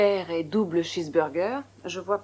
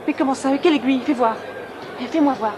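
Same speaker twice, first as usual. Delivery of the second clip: louder, higher urgent questions; loud anxious urgency; alarmed; 4 semitones higher, 5 dB louder than usual. Real, not acted. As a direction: insist on seeing the wound. Alarmed, demands to see.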